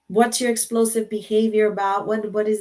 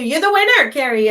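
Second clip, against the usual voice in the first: much higher and louder, celebrating; excited announcement; 7 semitones higher, 8 dB louder than usual. Real, not acted. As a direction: crown the winner with joy. Declaring winner excitedly.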